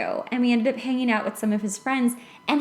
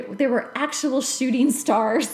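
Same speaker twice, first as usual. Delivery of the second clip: normal talking voice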